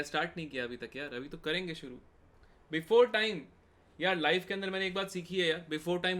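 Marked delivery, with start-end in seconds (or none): 2.7-3.4 bursts out higher and louder; cheerful, emphatic call of early start; excited exclamation. Real, not acted. Teacher telling chat being early is best, loud and upbeat.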